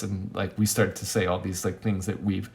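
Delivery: ordinary talk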